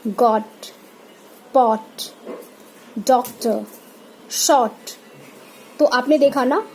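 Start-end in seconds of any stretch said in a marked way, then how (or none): 0.1-4.7 short, rounded open o in each word; short o demonstration. Shown as a demonstration. Teacher models the o sound word by word, short rounded vowel. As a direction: say each word separately with a brief o. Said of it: "इनमें ओ का sound आएगा".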